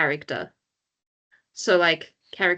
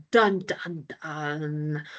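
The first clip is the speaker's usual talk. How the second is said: dramatic "dun dun dun"; much slower than usual. Shown as a demonstration. Tutor giving an example of dramatic sound effects, mock-ominous dun-dun-dun. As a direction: voice a dramatic sound effect, fading on the last note. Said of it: "dramatic sound effects".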